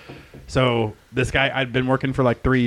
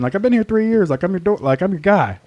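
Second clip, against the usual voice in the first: indignant protest; 7 semitones higher, 3 dB louder than usual. Retold or imitated. Protesting to the boss.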